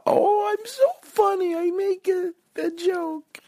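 very high, silly squeak; mock your own joke in a piping falsetto; self-mocking falsetto. Mocks own joke in put-on voice.

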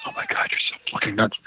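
low, unvoiced whisper to himself; whispered thought. Thinking to himself while Gary talks nonsense, whispered. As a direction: whisper it as a private aside. Voices his own private thought.

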